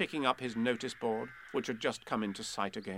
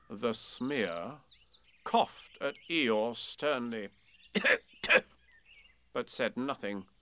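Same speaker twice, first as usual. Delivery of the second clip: ordinary delivery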